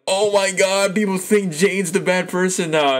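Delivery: high-pitched incredulous outcry; retold disbelief. Viewer recalling the unfair scene, high-pitched disbelief. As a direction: exclaim it high, as if appalled for Jane. Retells own outraged reaction to show.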